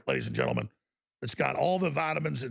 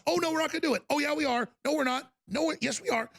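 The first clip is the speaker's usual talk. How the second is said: higher, sing-song mock bickering voices; mock denial and reversal; 10 semitones higher, faster than usual. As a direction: play both sides of a silly denial, pitched up. Mock back-and-forth of officials.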